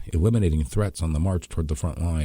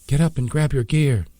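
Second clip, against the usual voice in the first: higher, louder barked command; sharp order; 9 semitones higher, 5 dB louder than usual. Acted. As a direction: snap the order at them. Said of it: "Bea ordered".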